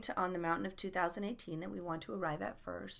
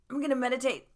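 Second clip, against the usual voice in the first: loud, higher-pitched mock resolve; abrupt mock announcement; 5 semitones higher, 8 dB louder, much faster than usual. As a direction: announce it too forcefully, as a send-up. Mimics abruptly sitting down to meditate.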